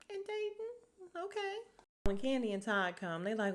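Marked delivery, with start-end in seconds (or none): 0.1-1.6 mock disbelief. Mocking couple's showmance.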